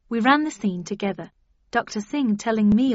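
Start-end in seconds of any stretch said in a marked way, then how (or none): none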